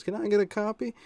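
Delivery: put-on high-pitched young customer; imitated kid. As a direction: mimic a boy asking, pitched well up. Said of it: "I didn't believe this kid at all".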